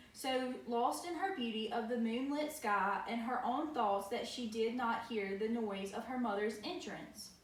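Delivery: ordinary delivery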